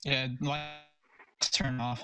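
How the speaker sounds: ordinary talk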